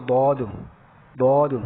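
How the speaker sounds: "دود" as a heavy, rounded dawd; thick daad. Shown as a demonstration. Teacher modelling the joined letter name, heavy "دود". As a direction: hold the dark open vowel in "دود". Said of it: "موٹا کر دیتے ہیں".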